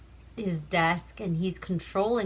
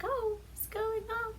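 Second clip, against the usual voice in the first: put-on high, scared girlfriend voice; panicky girlfriend; 8 dB quieter than usual. Girlfriend hears shots in the next room, high mock panic. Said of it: "The girlfriend's freaking out".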